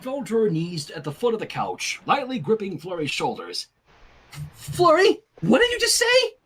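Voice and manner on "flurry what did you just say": high, urgent disbelief; shocked pleading question; alarmed question. Acted. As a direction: demand to know what she said.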